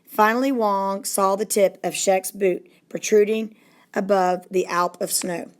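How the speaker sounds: usual speaking voice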